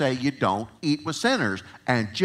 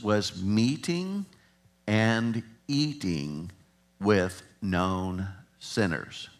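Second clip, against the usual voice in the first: slow emphatic; 2 semitones lower, much slower than usual. Shown as a demonstration. Slowed emphasis on key phrase.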